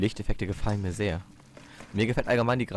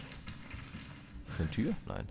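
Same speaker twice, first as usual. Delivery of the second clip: whisper; 12 dB quieter, much slower than usual. Real, not acted. Sneaking in horror game.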